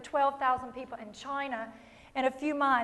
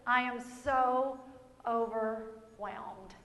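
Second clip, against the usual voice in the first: slow, much softer, sinking sigh; mock overwhelmed moan; 5 semitones lower, 11 dB quieter, much slower than usual. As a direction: let it sag under the weight.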